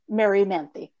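usual speaking voice

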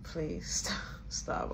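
hushed, breathless please stop; whispered plea. Retold or imitated. Pushing Tracy off from behind the couch, in a whisper. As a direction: beg her to stop under your breath. Pleading while Tracy hugs her.

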